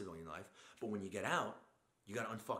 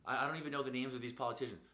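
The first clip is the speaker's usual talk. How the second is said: usual speaking voice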